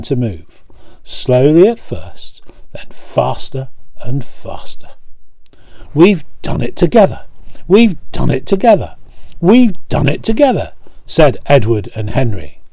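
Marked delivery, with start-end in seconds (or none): 5.9-10.7 rising higher each time, a happy triumphant cry; building joy. Acted. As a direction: start glad and climb to a cheer. Engines celebrate their success.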